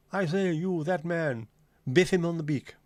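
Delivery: high, hammed-up posh English toff; posh English accent. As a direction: call out in a mock plummy posh English accent. Parodying a posh Cockney's speech.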